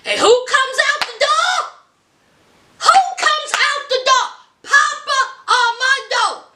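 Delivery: high, building excitement; dramatic reveal. Real, not acted. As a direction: build suspense, then announce. Dramatic reveal, higher and louder.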